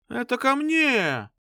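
high, slow teasing claim; flirty blonde. Acted. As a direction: pull him your way, playfully.

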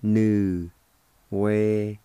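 nu, we, li, sa set apart; syllable-by-syllable pronunciation. Shown as a demonstration. Teacher breaking down nuwelisa, slow separate syllables. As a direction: sound out each syllable of nuwelisa with gaps. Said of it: "It's pronounced like this".

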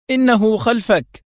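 urgent shout. Acted. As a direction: drive the warning home. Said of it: "صاح به زميله".

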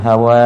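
ฮาวา with a long last vowel ending in h; breathy final h. Shown as a demonstration. Teacher shows learners how to stop, final h on ฮาวา. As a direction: let ฮาวา run out into an audible h. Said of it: "เวลาเราหยุดก็ออกเสียงฮะมาด้วยนะ".

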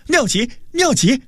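high, gleeful cry; delighted exclamation. A narrator's reading. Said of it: "大喜之下跳起身来，拍手叫道".

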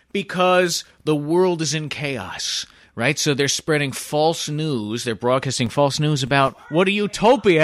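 slow pitch narration. Pretend. Telling movie pitch.

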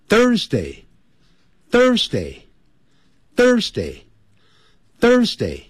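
Thursday drawn out with tongue-between-teeth th; slow Thursday. Shown as a demonstration. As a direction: slowly repeat Thursday with tongue out. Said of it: "舌头吐出来之后". (吐舌头慢念Thursday)

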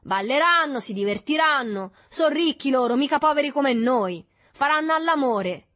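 high, loud mocking list; loud, sarcastic jeering; ironic. A narrator's reading. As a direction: rattle off their pleasures scornfully. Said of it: "disse Berto con ironia".

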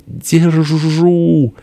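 high, long-held call; triumphant cry. A narrator's reading. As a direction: stretch it out high on the catch. Benedikt cries out catching the Murza. (протяжный высокий торжествующий выкрик)

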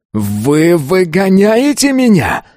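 high, drawn-out outraged yell. A narrator's reading. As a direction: cry it out in disbelief, voice shooting up. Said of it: "заорал он". (крик, высоко и протяжно)

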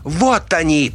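high, urgent shout of alarm; piercing high-pitched alert; piercing cry. A narrator's reading. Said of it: "пронзительно закричала Мидари".

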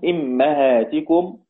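إمهاتكم drawn out as "immahātikum", i on hamza, a on mim; kasra hamza, fatha mim. Shown as a demonstration. Teacher showing al-Kisai's reading, إمهاتكم as slow "immahātikum". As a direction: say إمهاتكم very slowly, "im-ma-hā-ti-kum". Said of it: "والكسائي بكسر الهمزة وفتح الميم".

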